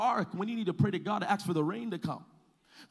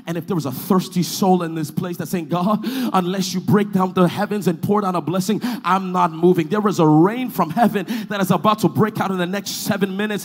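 full-force preaching; 16 dB louder than usual. Real, not acted. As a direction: build the refrain into a roaring declaration.

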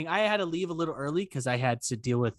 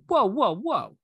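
high, louder mock "hold on"; retold interruption; 8 semitones higher, 5 dB louder, slower than usual. Stopping the yelling neighbor, imitated high interruption. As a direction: cut in to halt someone yelling.

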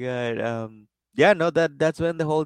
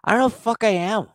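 put-on higher, confused drunk whine; lost drunk; 6 semitones higher than usual. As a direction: wander back lost and needy. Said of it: "turns around and goes".

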